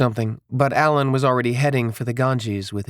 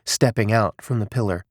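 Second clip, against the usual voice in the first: usual speaking voice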